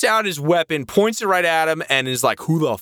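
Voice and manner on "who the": confrontational. Retold or imitated. Retold armed confrontation over his father.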